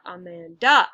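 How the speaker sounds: "Amanda" as aman-DAH, last syllable loud; wrong last-syllable stress. Shown as a demonstration. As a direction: hold back, then punch the final DA of "Amanda". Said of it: "the second syllable is stressed".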